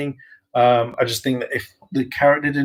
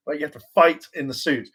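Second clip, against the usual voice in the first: normal talking voice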